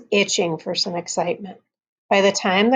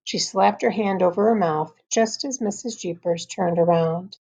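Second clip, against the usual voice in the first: ordinary delivery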